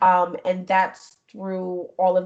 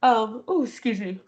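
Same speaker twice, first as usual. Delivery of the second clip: ordinary talk, nothing marked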